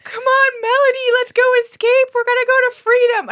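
put-on high, loud little-kid voice; hammed-up child rallying a pet, squeaky-high; Bobby. Little kid urging her pet cow to escape, high mock-child voice. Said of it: "So little Bobby is like".

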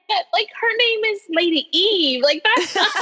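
high, mock-skeptical retort; dismissive. She scoffs at Ava's Lady Eve rap name, pitched high. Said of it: "And I was like".

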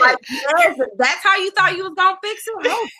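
high, loud disbelief; incredulous. Real, not acted. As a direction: call out the silly fix. Outraged at Mark's proposal.

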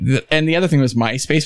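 ordinary delivery

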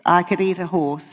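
ordinary talk

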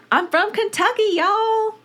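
high, twangy mock Southern drawl; Southern accent. Reading a listener's email in a mock Southern accent. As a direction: ham up a Kentucky drawl reading the quote. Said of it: "Literally, it says that".

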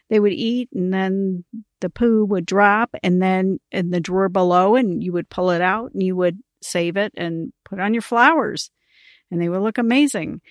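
ordinary talk, nothing marked

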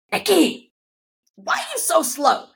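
high-pitched mock scolding from a teacher; retold scolding. Teacher calls out a student in yoga practice, retold scolding.

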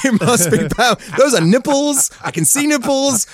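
high, loud, hammed-up amazement; mock excited realisation. His twelve-year-old brain spotting Pamela, high mock excitement. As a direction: play a thrilled kid jumping to conclusions.

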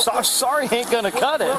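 stern scolding. Real, not acted. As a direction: scold her, unimpressed. Officer scolds the mother.

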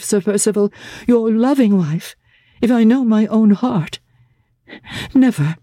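put-on a young lady refusing with sudden spirit; Laura protesting. Laura refuses Sir Percival.

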